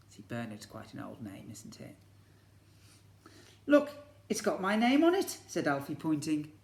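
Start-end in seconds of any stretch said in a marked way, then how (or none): 0.1-1.9 soft whisper to the class; whispered aside. Real, not acted. Teacher whispers an aside to the children, hushed. 3.6-5.4 put-on higher, louder eager child voice; little boy Alfie. Alfie shows Mum his invitation, eager child voice.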